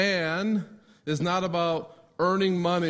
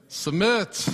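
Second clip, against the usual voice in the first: louder, higher call of the word Submit; called out answer; 4 semitones higher, 5 dB louder than usual. Real, not acted. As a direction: answer Submit out loud together. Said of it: "I'm gonna have you guys say it".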